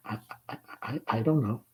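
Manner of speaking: very soft, halting stammer; shy girl asked if she is shy; thin, breathless. Acted. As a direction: barely get the words out. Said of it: "Laura's voice is thin and breathless".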